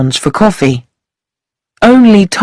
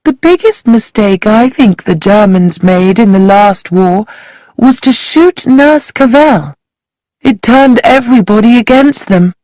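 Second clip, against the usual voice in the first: thin voice; 5 semitones higher than usual. Acted. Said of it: "in her thin voice".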